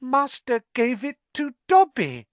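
awed elf. Acted. Elf still marvelling.

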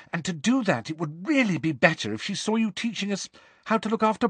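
high-pitched, insistent coaching; high, pleading schoolgirl; earnest. A narrator's reading. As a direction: press the advice on him earnestly. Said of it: "said Hermione earnestly".

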